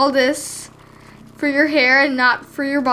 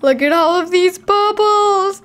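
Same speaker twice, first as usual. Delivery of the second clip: ordinary talk, nothing marked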